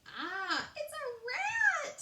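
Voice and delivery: very high, loud, slow crying squeal; terrified squeal. A narrator's reading. Princess squealing at the rat on the table. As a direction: shriek with fright. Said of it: "squealed Princess P".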